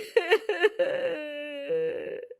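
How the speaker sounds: high trailing sob; a crying wail; sobbing wail. Acted. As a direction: let the sob fall away. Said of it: "जोर जोर से रोने लगती है".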